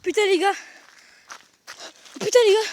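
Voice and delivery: high alarmed shouts; frightened shouting. Real, not acted. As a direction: shout in fear of the chaser. Being chased, shouting in fear. (crier de peur)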